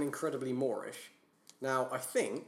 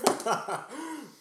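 burst of laughter; 12 semitones higher than usual. Real, not acted. Laughs at rash move question.

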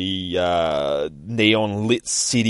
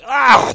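high, loud scream of pain; 10 dB louder than usual. Acted. Nightwing stabbed in the ribs, loud pained scream. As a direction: cry out as if stabbed. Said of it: "Nightwing screams as he fucking keels over in pain".

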